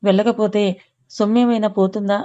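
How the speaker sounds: ordinary delivery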